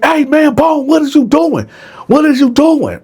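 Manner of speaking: the narrator jumping up and yelling at Bone; alarmed shout. Retold or imitated. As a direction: shout at him to stop.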